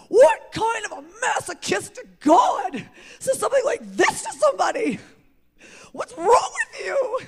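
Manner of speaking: high, loud, outraged shout, laughing and near tears at the end; retold railing at God. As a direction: rage at God, half laughing. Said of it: "I would rail at God".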